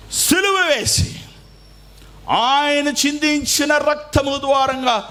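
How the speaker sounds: high, slow, ringing proclamation; preaching at full force. Acted. Pastor proclaiming to the congregation, high and slow. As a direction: lift it up high and slow for the church.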